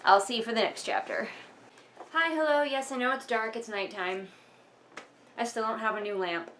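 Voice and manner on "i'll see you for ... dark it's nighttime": normal talking voice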